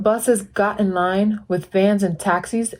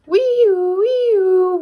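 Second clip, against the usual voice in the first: high, louder wee-oo siren; siren wail; 13 semitones higher, 4 dB louder than usual. Acted. Reader imitating a police siren, high and loud.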